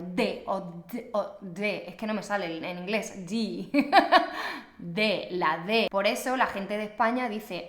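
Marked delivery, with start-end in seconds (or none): 0.1-1.8 D o D o D with a hard stopped D, tongue on the teeth; hard Italian D. Shown as a demonstration. Teacher showing the wrong hard D. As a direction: demo of the strong dental D. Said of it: "No digo D, como por ejemplo en italiano".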